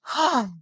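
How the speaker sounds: a heavy, tired exhale of relief; long weary sigh. Retold or imitated. To the listeners, after the mall, a weary sigh. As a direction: let the stress out in one sigh.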